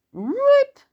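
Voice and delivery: very high, loud storybook rip; ripping sound effect. A narrator's reading. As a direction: imitate paper tearing with the word. Sound effect of opening presents.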